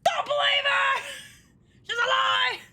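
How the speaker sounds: high, playful protesting shout; high-pitched comic denial; mock outcry. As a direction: cry out in mock alarm.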